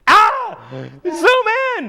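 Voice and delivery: mock shout. Acting out a cameraman.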